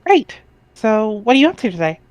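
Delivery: put-on perky girl reading her chat lines up high; [name]'s chat voice.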